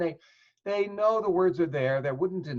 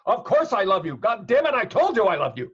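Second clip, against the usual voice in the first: loud, higher, exasperated shout; angry; 5 semitones higher, 7 dB louder than usual. Retold or imitated. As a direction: snap it back like a fed-up husband. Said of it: "the melody says, "I'm angry".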